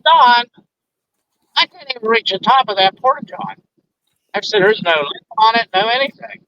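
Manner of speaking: retold indignant denial; protesting innocence. Protesting his innocence to coworker.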